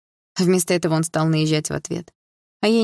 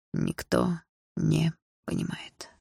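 slow, clipped-off, softer words; curt and quiet; 7 dB quieter, much slower than usual. Acted. Lyokha cuts off Dilara's sympathy, curt and quiet. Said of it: "отрывисто сказал парень".

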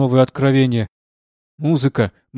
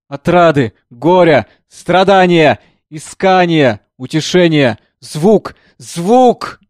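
high, loud, ecstatic cry over the keys; frenzied shout; 4 semitones higher, 8 dB louder, slower than usual. Acted. Composer at the piano defying death, ecstatic shouting. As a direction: hammer out defiant triumph. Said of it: "кричал".

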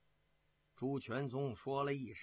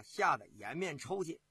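ordinary delivery

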